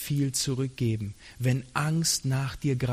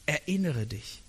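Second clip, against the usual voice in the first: softer echo; 7 dB quieter than usual. Shown as a demonstration. Preacher echoes the third step, hushed. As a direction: repeat it much softer. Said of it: "Und der dritte".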